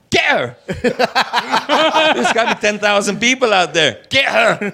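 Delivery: amused mock command; laughing retold order. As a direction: bark the order through laughter. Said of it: "I said".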